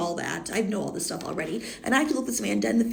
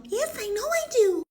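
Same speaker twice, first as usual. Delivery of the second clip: very high, slow princess voice. Acted. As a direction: answer in a high-pitched storybook princess impression. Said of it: "you do the voice".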